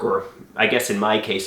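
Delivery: ordinary talk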